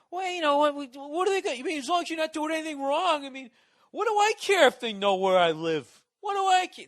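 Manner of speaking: high, loud mock-dopey voice; high far-off airhead voice, shrugging it off; mocking naive dupe. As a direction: mimic a clueless sheep who doesn't care. Mocking naive people's attitude.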